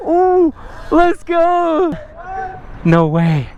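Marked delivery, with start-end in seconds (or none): 0.1-1.9 hyped high cheering; pumped-up bro yells; frat bro. Real, not acted. Hyped reunion with friends.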